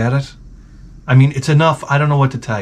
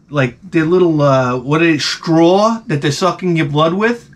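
ordinary delivery

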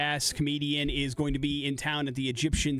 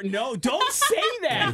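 laughing protest; 7 semitones higher, 4 dB louder, much slower than usual. Real, not acted. Begging guest not to joke.

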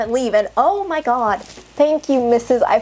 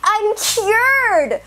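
high-pitched mock amazement; hammed-up, high joyful revelation; sarcastic elation; 9 semitones higher than usual. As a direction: act out a fake miracle recovery. Sarcastic acted miraculous recovery.

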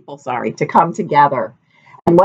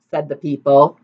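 ordinary delivery

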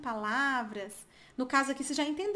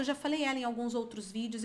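normal talking voice

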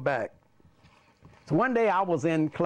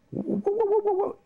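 high, repeated stuttered onset; mock stammering principal; imitating the principal's stammer. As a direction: get stuck on the first sound in a high voice. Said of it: "he started talking like this".

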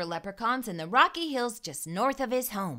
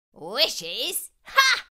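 scornful high ha; mock the very idea; scoffing; 3 semitones higher, 5 dB louder than usual. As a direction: leprechaun scoffing at the boy. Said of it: "the leprechaun scoffed".

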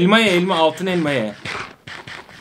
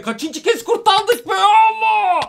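high, slow, incredulous outcry; frantic exclamation; slower than usual. Real, not acted. Gamer after another narrow escape, frantic outcry. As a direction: draw it out into a high shout.

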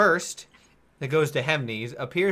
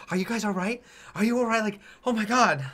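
concerned passers-by; 7 semitones higher, faster than usual. Acted. Passers-by checking on the wounded.

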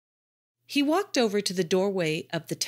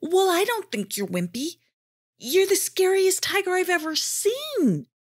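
high, earnest little voice; reassuring Jardad; 12 semitones higher, 4 dB louder than usual. A narrator's reading. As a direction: cheer the tiger up sincerely.

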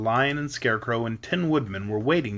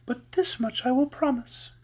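much higher, airy promise; sweet lovely lady; 11 semitones higher than usual. A narrator's reading. Lady Oz promising brains to Scarecrow, sweet. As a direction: promise it lightly, high and smooth.

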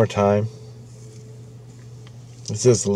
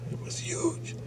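whispered, awed repeat; awed whisper of the size; 17 dB quieter, slower than usual. Retold or imitated. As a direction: whisper it for effect.

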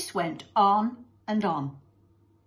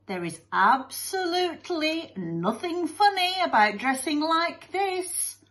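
displeased teacher; 8 semitones higher than usual. Acted. Mister Lovey-Dovey tells off laughing kids, displeased. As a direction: insist huffily, stretching the last words. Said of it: "looked most displeased".

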